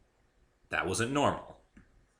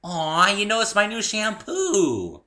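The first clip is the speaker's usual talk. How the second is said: higher, louder, slow sugary pony; higher sugary pony coo; sweet pony; 13 semitones higher, 10 dB louder, slower than usual. A narrator's reading. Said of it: "said the pony sweetly".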